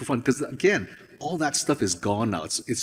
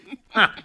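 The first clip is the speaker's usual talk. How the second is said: own laugh; 9 semitones higher, 4 dB louder than usual. Real, not acted. Laughing after own demo.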